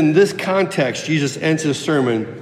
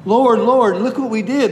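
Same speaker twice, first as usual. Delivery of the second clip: sincere pleading; 6 semitones higher than usual. Retold or imitated. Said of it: "These people were sincere".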